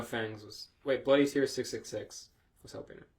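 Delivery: usual speaking voice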